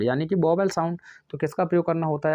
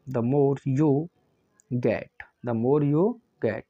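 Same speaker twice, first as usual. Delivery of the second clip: slow, word by word, for students to copy; slow dictation; 2 semitones lower, much slower than usual. Shown as a demonstration. Teacher dictating to the class, slow and deliberate. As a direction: spell it out slowly as you dictate.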